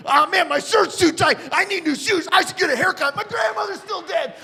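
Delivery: high-pitched mock panic; freaking out. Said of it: "I'm freaking out in this movie theater".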